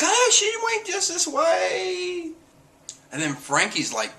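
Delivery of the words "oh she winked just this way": very high, drawn-out squeal; hammed-up fanboy delight; mock swooning. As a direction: squeal it slowly like smitten fans. Voicing the crew's swooning reaction.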